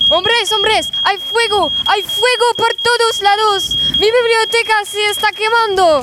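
appel affolé nettement plus haut; alarme paniquée. Acted. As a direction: crie à l'aide, la bibliothèque brûle. Il crie au feu. (Don Quichotte appelle au secours devant l'incendie, paniqué)

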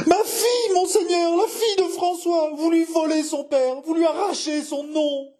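very high, tearful wail; hysterical pleading. Acted. Jeanne pleading for François's daughter to monseigneur, hysterical pleading. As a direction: accuse and beg in melodramatic anguish.

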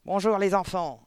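loud barked greeting to a class; aboiement. A narrator's reading. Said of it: "aboya-t-elle". (salutation aboyée, forte)

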